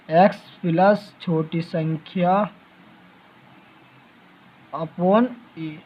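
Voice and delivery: slow, word by word, as if dictating a formula; slow dictation. Shown as a demonstration. Teacher to students writing the formula, slow dictation. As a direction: spell the formula out slowly so students can copy.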